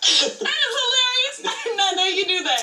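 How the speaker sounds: high, shrieking laughter through the words; laughing in disbelief. Real, not acted. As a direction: burst out laughing, caught completely off guard. Caught out, bursts out laughing.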